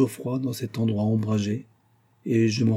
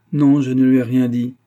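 firm loud denial; 3 semitones higher, 9 dB louder than usual. A narrator's reading. Heroine answers firmly.

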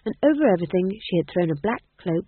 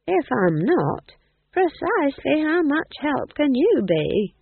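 slow, wry, rising to higher pitch; dry old witch retort; 8 semitones higher, 3 dB louder, slower than usual. Acted. Nanny Ogg teasing the worried ghost king, slow and wry. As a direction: tease his uselessness, slow and wry.

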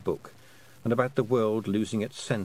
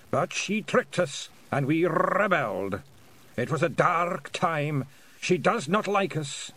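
high, deliberate bard with drum-roll R's; clear, slow, rolled R's; 6 semitones higher than usual. A narrator's reading. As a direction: recount it slowly and clearly, rolling the R's. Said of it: "more clearly and slowly than the others".

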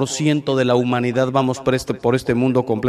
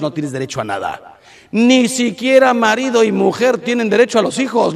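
laughing rebuttal; 8 semitones higher than usual. Real, not acted.

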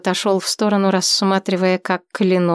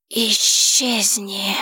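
muffled hissing dragon voice; much slower than usual. A narrator's reading. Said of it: "глухой и шипящий".

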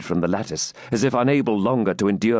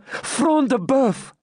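higher, slow, drawn-out confirmation; emphatic answer; much slower than usual. A narrator's reading. Maiden confirms to knight who fell, emphatic. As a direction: proclaim the name slowly and high.

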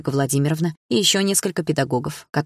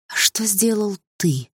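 honey-sweet, with menace underneath; gentle but unsettling question to the bully; tender; 5 semitones higher, slower than usual. Acted. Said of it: "спросила я нежно".

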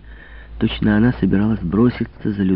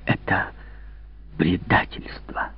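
bitter whisper; 8 dB quieter, slower than usual. Acted. Betrayed king, bitter.

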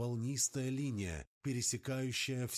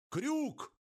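high, loud yell over the wind; shouting over a storm; 4 dB louder than usual. Acted. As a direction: bellow it against the gale. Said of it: "Гор старался перекричать бурю".

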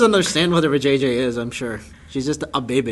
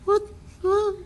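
mock confused; 3 dB quieter than usual. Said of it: "he's like".

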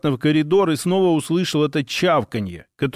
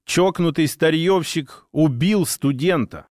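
ordinary delivery

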